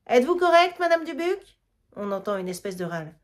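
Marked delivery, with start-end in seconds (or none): 0.1-1.6 high, loud call from a distance; calling from far off to the fallen old woman. Acted. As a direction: shout down the stairs to her. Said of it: "de très loin".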